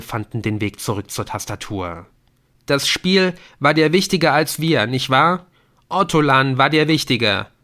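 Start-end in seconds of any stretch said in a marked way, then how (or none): 2.7-7.5 louder, bitter son; hurt accusation. Acted. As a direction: accuse him of loving the game more. Adrian accuses his father. (verletzte, laute Anklage)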